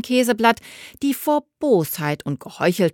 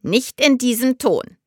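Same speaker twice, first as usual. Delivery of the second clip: admonishing; 6 semitones higher than usual. A narrator's reading. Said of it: "ermahnt Dorothea ihre Tochter".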